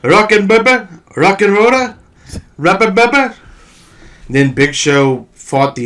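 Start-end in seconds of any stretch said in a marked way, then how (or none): 0.0-3.4 Santino impression. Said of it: "Santino when he couldn't get his name right".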